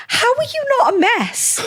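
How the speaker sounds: high, choked-up friend's voice; emotional friend. Retold or imitated. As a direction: imitate a tearful friend, pitched high. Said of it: "and she's like".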